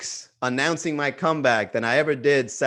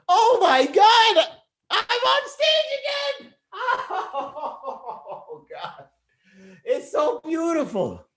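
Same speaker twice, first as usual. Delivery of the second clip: high, weepy mock sob of joy; hammed-up overjoyed crying; tears of joy; 13 semitones higher, slower than usual. Comedian acting out weeping on stage again, mock tearful joy. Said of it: "I'm gonna go up with tears of joy".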